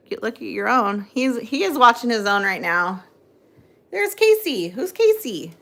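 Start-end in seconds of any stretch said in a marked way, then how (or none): 3.9-5.5 put-on baby talk to dog.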